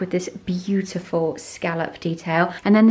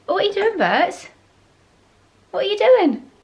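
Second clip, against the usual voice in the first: dog voice; 11 semitones higher, 4 dB louder than usual. Real, not acted. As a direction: coo at the pet in a squeaky voice. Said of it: "Sorry about my dog voice".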